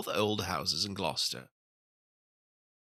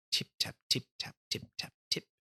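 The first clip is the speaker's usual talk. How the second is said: soft, breathy tip-tap like tiny feet; whispered tapping; 5 dB quieter than usual. A narrator's reading. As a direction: whisper the tapping like little mice. Tapping sounds in the story.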